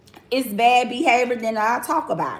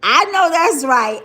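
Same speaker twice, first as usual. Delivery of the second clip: loud; 5 semitones higher, 8 dB louder than usual. Real, not acted. Emphatic agreement, repeated.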